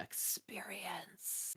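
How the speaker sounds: breathy whispered word; ASMR whisper. Pretend.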